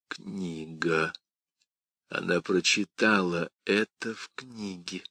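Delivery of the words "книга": ordinary delivery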